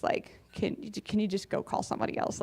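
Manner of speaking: hushed, frightened whisper; timid whispered plea to God; scared whisper. Retold or imitated. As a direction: whisper it like a nervous man begging off. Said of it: "he was scared".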